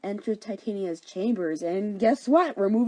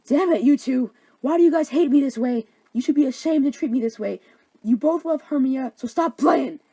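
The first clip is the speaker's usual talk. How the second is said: loud, raised-pitch indignation; angry outburst; 5 semitones higher, 7 dB louder than usual. Acted. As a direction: scold them both at hammed-up full volume. Helena furious at both suitors.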